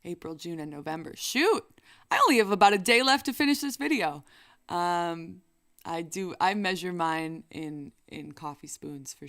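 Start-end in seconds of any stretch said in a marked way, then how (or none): 1.2-4.2 loud, fast panicked line; flustered. Real, not acted. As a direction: rush out in alarm. Realizing deadline.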